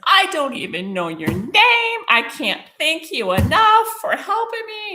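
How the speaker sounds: high, loud, re-enacted tearful wail; acting out overwrought thanks; hysterical crying. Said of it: "I'm still crying hysterically".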